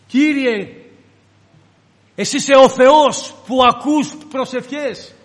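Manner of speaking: fervent prayer. Acted. Disciples praying for Peter's release, loud and fervent. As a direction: cry out in hope to God.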